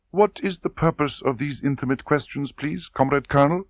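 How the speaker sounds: put-on wary girl pushing back politely; timid recruit facing her interrogator; young woman voiced. Tatiana questioned by colonel.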